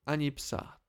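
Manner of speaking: ordinary delivery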